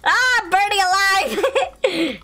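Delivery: high, slow, drawn-out wail; burning scream. Pretend. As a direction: cry out as if on fire. Character burning in flames.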